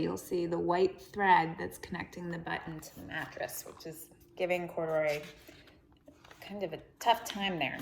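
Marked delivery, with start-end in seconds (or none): none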